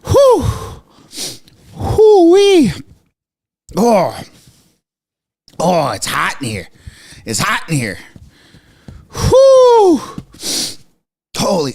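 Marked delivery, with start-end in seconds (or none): 0.0-2.7 high loud whistle of relief; relieved whew. Real, not acted. A streamer, overheated by the news, blowing out a loud whew. 9.1-10.0 high loud whistle of relief; relieved whew. Real, not acted. A streamer, overheated by the news, blowing out a loud whew.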